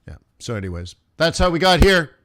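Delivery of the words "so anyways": usual speaking voice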